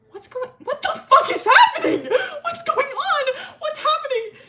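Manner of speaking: very high, loud squealed yelling through laughter; mock panicked shriek. [name] freaking out at the scene, mock panicked screaming.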